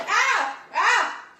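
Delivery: sharp startled cries of pain; pained yelps. Real, not acted. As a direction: yelp as the hot oil hits your hand. Hand burnt on hot pan.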